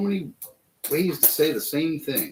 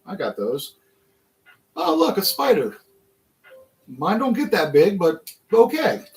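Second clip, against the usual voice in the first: unimpressed tourist; 6 semitones higher than usual. Retold or imitated. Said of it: "I'd be like".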